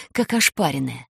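usual speaking voice

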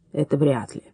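put-on low grumbling old miner. A narrator's reading. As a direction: grumble it under breath. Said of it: "Пожилой рудокоп проворчал".

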